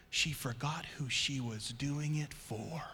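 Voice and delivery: dramatic hushed whisper; breathy emphatic sermon whisper. Shown as a demonstration. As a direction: drop to a whisper to make it land. Preacher drops to whisper for effect.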